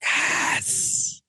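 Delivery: drawn-out, hissed whisper of triumph; long sibilant celebratory whisper; whispered cheer. Real, not acted. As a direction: whisper it like a private little victory. Celebrates co-host being tempted.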